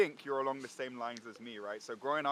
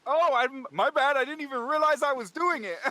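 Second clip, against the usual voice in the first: high, loud fake-innocent apology; mock innocent excuse; 13 semitones higher, 11 dB louder than usual. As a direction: play dumb, loudly and high. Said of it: "he's like".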